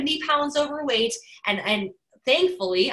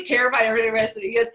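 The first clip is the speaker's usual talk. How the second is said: usual speaking voice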